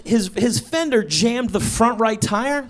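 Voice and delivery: normal talking voice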